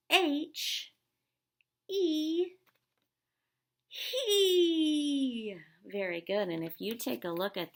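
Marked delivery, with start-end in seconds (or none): 0.1-5.6 high, loud flexing shout of each letter; tough muscle voice. Shown as a demonstration. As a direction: pump up your muscles and belt it out. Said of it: "One more time".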